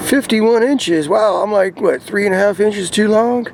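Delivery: ordinary delivery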